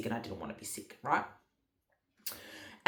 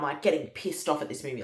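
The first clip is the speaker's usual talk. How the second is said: normal talking voice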